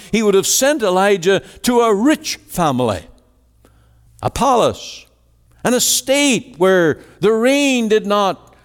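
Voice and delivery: high, building rhetorical questions; impassioned preaching. Acted. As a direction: preach it with mounting incredulity. Preacher voices mounting incredulous questions.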